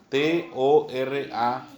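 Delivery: T O R A spelled slowly, letter by letter; slow dictation of T O R A, one letter at a time. Shown as a demonstration. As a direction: spell out T O R A with space between the letters. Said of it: "literalmente quiere decir Torá".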